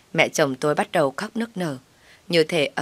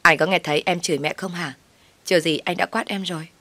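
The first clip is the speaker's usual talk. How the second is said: ordinary delivery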